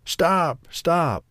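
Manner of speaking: high-pitched, lightly acted wheezing call; out of breath. A narrator's reading. Old couple call while chasing down the road, mildly wheezy. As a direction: pretend to be puffed from running. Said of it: "they wheezed out of breath".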